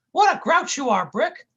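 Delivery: very high, bumbling goofy voice; squeaky clumsy oaf, loud; goofy cartoon sidekick. A narrator's reading. Dummerton's voice.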